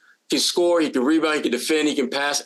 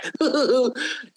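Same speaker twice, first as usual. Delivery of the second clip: put-on little-kid giggle; 8 semitones higher than usual. Mimicking the young player after meeting Shaq, high giggle. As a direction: titter high like a thrilled kid. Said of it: "like a little kid giggling".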